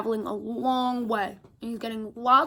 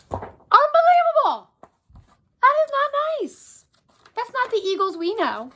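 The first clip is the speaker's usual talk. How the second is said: mock outrage; 10 semitones higher than usual. Reader outraged at eagle.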